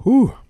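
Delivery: high, loud exhaled whew of relief; real relief gasp once the choke clears; relieved whew. Real, not acted. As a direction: blow out the air after nearly choking. Recovering after a choking hiccup.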